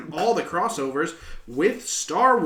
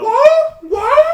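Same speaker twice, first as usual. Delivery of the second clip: shocked laughing disbelief; 13 dB louder than usual. Real, not acted. Shocked at the lizard sound.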